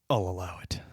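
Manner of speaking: a whispered mock judge ruling. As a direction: breathe it out like a judge giving in. Judge grants the excuse.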